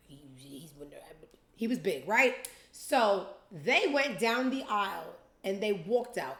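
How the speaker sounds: ordinary delivery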